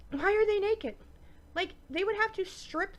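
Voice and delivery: exasperated. Real, not acted. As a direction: ask it in disbelief. Repeats the baffled question.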